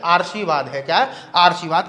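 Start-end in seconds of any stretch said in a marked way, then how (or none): 0.1-0.7 "आशीर्वाद" read as aar-shi-vaad, r before the sh; misplaced r. Shown as a demonstration. Teacher reading out the misspelling to pupils, "आशीर्वाद" as aar-shivaad. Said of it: "रा का उच्चारण अगर आप यहाँ". 1.4-1.9 "आशीर्वाद" read as aar-shi-vaad, r before the sh; misplaced r. Shown as a demonstration. Teacher reading out the misspelling to pupils, "आशीर्वाद" as aar-shivaad. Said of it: "रा का उच्चारण अगर आप यहाँ".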